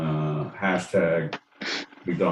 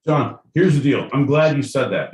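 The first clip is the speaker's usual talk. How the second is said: loud, high mock-indignant comeback; fired-up retort; 6 semitones higher, 6 dB louder than usual. Real, not acted. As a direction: come back at him all fired up. Mock-indignant rebuttal after laughing.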